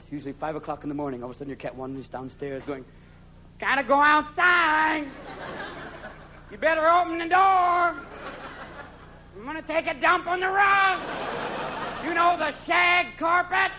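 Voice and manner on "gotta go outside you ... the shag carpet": high, slow, drawn-out yowl; high-pitched cat whine, stretched out long; demanding cat. Acted. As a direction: yowl the words like an insistent cat.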